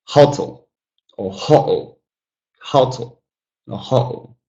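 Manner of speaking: "hotel" stressed HO-tel, second syllable swallowed; stress on first syllable. Shown as a demonstration. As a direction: punch HO, let the rest of "hotel" drop away. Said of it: "stress the first out of two syllables".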